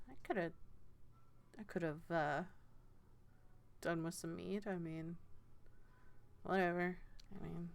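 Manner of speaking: a touch of a quiet offhand murmur; quiet mumble. Acted. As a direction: mumble it half to yourself, uninterested. Estet speaks quietly.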